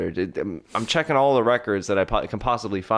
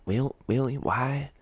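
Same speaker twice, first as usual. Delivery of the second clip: put-on thick Southern accent. Prisoner to detective, sudden thick Southern accent. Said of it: "you just got an accent really thick".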